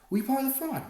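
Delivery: loud, high shout; reporter shouting. A narrator's reading. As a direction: shout it at him over the crowd. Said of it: "one shouted".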